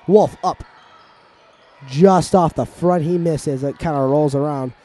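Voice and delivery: lively live commentary, building excitement; play-by-play. Acted. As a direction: call the game like a sports announcer. Play-by-play resumes.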